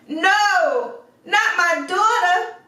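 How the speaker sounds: very high, loud desperate cry; horrified exclamation. A narrator's reading. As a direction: cry out to save his daughter. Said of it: "exclaimed the merchant".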